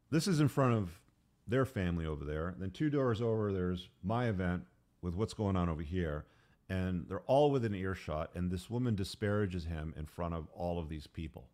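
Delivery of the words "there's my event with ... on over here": ordinary delivery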